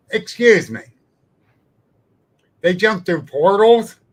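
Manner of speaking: retold angry confrontation at a cookout; angry challenge. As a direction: confront him hard and incredulous. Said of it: "now I'm mad".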